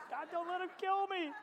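high, panicked mock plea to God; squeaky comic cry for mercy; desperate prayer. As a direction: pitch it up high, hammed-up begging. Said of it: "now I really am praying".

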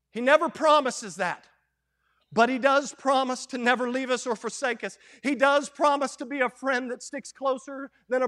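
preaching. Acted. Preacher rallying the church with repeated promises. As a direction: drive each promise home.

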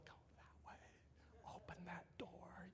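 whispered hints, slow; slow secret whisper to a toddler; stage whisper. Retold or imitated. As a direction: hiss the directions softly so grandma won't hear. Coaching toddler in hide and seek.

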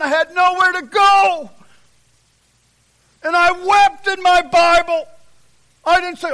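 loud, high testimony building to a shout; full-force preaching. Real, not acted. Preacher recalling weeping in his Bible, impassioned shouted testimony. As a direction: build steadily until you are shouting it out.

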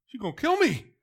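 high, loud mock-frightened wail; mock fear. Timid person dreading telling her, mock fear. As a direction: play up being scared for laughs, pitch jumping up. Acting intimidated person approaching Lauren.

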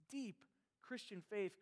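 ordinary talk, nothing marked

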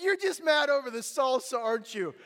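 loud, high-pitched accusation; confronting. Retold or imitated. Father catching his son red-handed, acted confrontation.

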